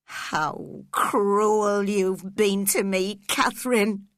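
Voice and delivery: loud, slow anguished cry; cried wildly. A narrator's reading. Heathcliff crying out wildly at dying Catherine. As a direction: hurl the reproach at her, wild with hurt. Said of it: "he cried wildly".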